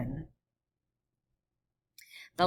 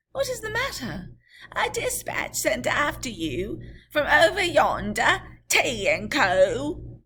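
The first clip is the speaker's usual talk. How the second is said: much higher call, slowing at the end; rider voice; 8 semitones higher, 7 dB louder than usual. Acted. Rider answering Mr Lorry, high. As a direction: call up from the road.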